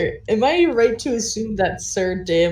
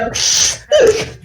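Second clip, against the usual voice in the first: dragon hiss; 14 semitones higher, 5 dB louder than usual. Acted. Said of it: "flare his wings and go".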